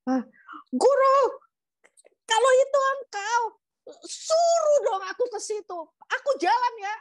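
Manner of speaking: very high, loud acted shout; high-pitched, reckless calling out; daring call. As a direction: holler a bold challenge to the teacher. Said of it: "Dia bilang gini".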